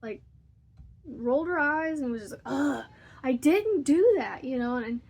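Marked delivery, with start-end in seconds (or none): 2.5-4.4 louder, drawn-out ugh and denial; annoyed teen snap. Retold or imitated. Mother imitates her irritated daughter snapping back. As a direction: mimic a fed-up daughter rolling her eyes. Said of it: "She got so mad".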